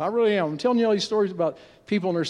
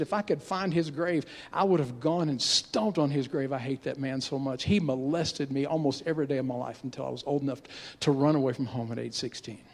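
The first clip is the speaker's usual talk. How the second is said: a hurt woman forcing out each word; hushed reluctant confession; 6 semitones lower, 6 dB quieter than usual. Retold or imitated. A hurt woman forcing out her story to her pastor, very hushed. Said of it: "having to drag every word out of her mouth".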